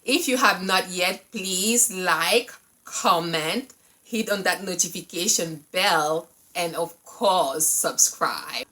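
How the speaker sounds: loud, bright host greeting; energetic vlog intro. Acted. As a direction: pump up the channel intro.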